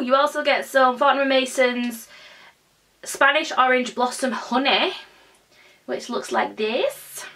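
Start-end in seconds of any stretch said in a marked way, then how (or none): none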